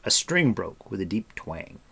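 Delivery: plain reading voice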